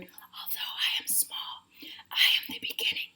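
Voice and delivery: soft unvoiced whisper; tiny seed whispering. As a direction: breathe it out as a whisper. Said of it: "the seed whispered".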